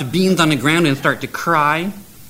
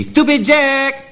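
drawn-out high yell of the name; long yell; 10 semitones higher, much slower than usual. Acted. Man with a pig yelling at Jack, drawn-out high call. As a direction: stretch the name out as if yelling it into his head.

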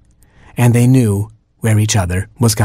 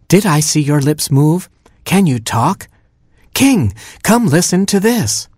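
higher, astonished queen calling out; rising wonder as she calls the king; amazed queen; 7 semitones higher than usual. Acted. Queen amazed by talking baby.

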